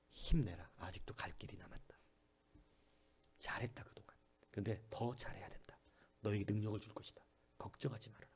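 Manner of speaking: hushed, gentle encouragement, mostly breathed; tender whisper. Acted. The Lord comforting a weary believer in a tender whisper.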